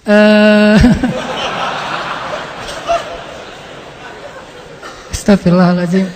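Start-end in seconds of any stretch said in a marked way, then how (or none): 0.8-3.2 breathy high laugh, swept away by it; real helpless laugh. Real, not acted. Preacher caught up in the story, real laughter. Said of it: "Terbawa suasana".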